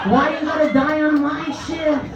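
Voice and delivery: high-pitched, drawn-out exasperated rant; exasperated complaint. Acted. Night-shift nurse to a dying elderly patient, exasperated.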